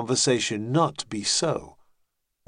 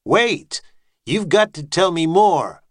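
high, loud call after them; urgent shout; 7 semitones higher, 7 dB louder than usual. Acted. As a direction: cry out to stop them leaving. Calls after the withdrawing head.

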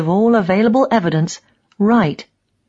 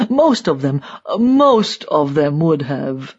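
put-on emphatic elderly East German die-hard; slow, insistent old man's character voice; old regime propagandist; 3 semitones lower, slower than usual. A narrator's reading. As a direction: say it as a stubborn old true believer, drawn out.

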